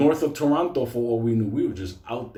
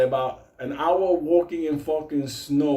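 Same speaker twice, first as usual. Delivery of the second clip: ordinary delivery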